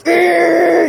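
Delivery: short high wailing whistle noise; imitated work whistle. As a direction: mimic the factory whistle with your voice. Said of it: "the tone was in that whistle".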